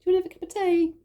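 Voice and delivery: high, fast, kind offer of tea; lightly voiced kind church lady; sweet helper. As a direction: chirp it like a caring auntie. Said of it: "the lovely Wahine then took me".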